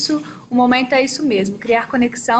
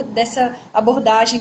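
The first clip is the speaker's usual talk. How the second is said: ordinary talk, nothing marked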